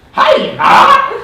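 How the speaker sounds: "Hi, ah!" shrieked as a broken, very high hyena; loud, high mock mispronunciation of "Hi, ah!"; mispronounced hyena. Shown as a demonstration. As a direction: yelp "Hi, ah!" as a crazily wrong word. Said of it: "say it right".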